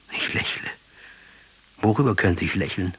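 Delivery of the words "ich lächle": whispered in wonder; soft whisper. Acted. Rebel whispers in surprise at his own smile. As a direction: let it slip out under the breath.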